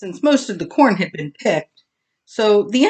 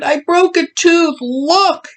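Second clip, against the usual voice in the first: high, loud, tearful complaint; crying; 9 semitones higher, 4 dB louder than usual. Acted. Bully showing Oakley his tooth, tearful. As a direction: show the hurt through tears. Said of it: "Bully cried".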